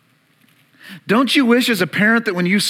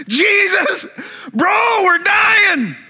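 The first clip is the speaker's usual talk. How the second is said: high, loud panicked yelling; panicked cry; 12 semitones higher, 3 dB louder, much slower than usual. Retold or imitated. Disciples shouting at sleeping Jesus, panicked. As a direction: cry out like you're drowning.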